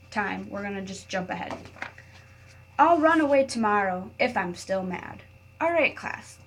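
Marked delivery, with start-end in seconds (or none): 2.8-5.2 put-on reading to children. A narrator's reading. Teacher reading a picture book to her class, expressive read-aloud. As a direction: read it like story time for little kids.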